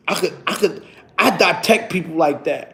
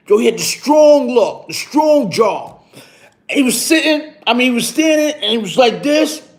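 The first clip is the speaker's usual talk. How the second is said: usual speaking voice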